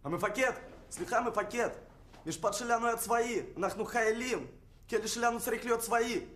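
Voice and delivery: loud high defiant yell; shouting protest. Acted. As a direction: shout at the commander. Prisoner protests to commander.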